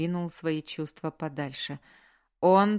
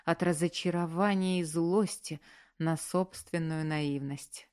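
ordinary delivery, nothing marked

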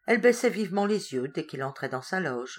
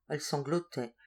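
usual speaking voice

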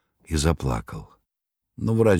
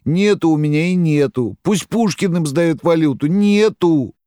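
high, loud anguished cry; bitter shout; 12 semitones higher, 5 dB louder than usual. A narrator's reading. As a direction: cry it out in despair. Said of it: "горько говорил".

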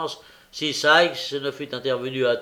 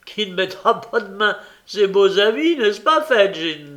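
high, loud, merry teasing; amused, boisterous jibe; laughing question; 6 semitones higher, 7 dB louder than usual. A narrator's reading. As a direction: ask it as a joke, voice climbing. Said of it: "demanda Charles Bates en riant".

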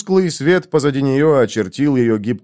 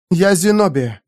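usual speaking voice

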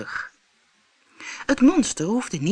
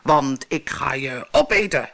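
booming monster; 3 semitones lower than usual. Acted. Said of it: "bulderde het monster".

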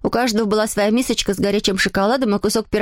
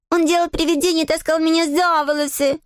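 put-on high-pitched child's complaint; little girl tattling; 5 semitones higher than usual. A narrator's reading. Adele tells parents her brother pulled hair, high and complaining. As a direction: tell on him in a high girlish voice.

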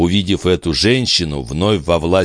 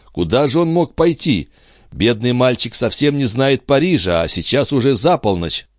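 lifted, anxious voice of a father; a touch of alarm, pitch raised; worried father; 7 semitones higher than usual. Acted. Жильбер тревожится за сына. (встревоженный отец, повышенным тоном)

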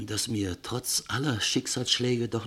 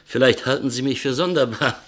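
usual speaking voice

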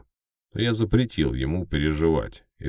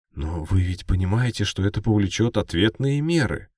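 higher, louder, trembling voice; nervous reporter; 6 semitones higher, 4 dB louder than usual. Acted. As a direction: push back with a shaking voice. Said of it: "голос у него явно дрожал".

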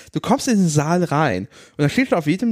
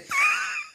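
high laugh; 6 dB quieter than usual. Real, not acted. Laugh after the joke.

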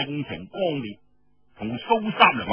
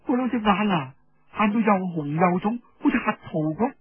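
higher voice, rising with worry; worried young woman; 5 semitones higher than usual. Acted. Hong Xuanjiao telling Feng about Su Sanniang, anxious and high. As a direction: pour out the worry, pitch climbing.

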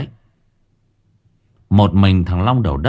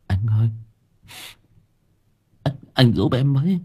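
higher, stumbling frightened begging; stammering plea; 4 semitones higher than usual. Acted. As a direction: trip over the words, scared. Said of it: "Thằng Quang lắp bắp". (giọng lắp bắp van xin)

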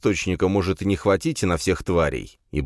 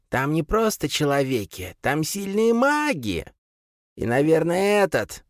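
creature mother; 10 semitones higher than usual. A narrator's reading. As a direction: warn them grimly. Mother warns sons.

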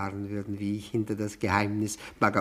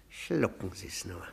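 whispered sneer; 7 semitones lower, 10 dB quieter than usual. Acted. Guest mocking his host, whispered. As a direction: hiss out the compliment.

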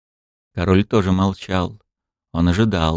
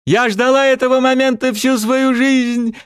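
triumphant gloating; 4 dB louder than usual. Acted.

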